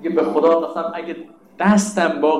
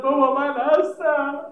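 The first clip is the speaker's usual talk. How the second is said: put-on high, slow small voice of a child; a hint of a crying infant; child's tearful call; 9 semitones higher, slower than usual. (صدای بلند و کودکانه با بغض)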